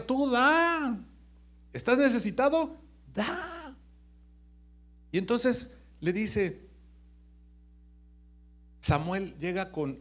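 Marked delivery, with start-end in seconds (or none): 0.0-3.8 urging to give. Retold or imitated. Preacher urging giving.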